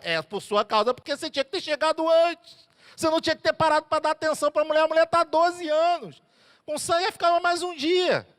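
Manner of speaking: a father furious over his dead daughter; rising indignant outburst; angry accusation. Retold or imitated. Jairo blames Jesus again.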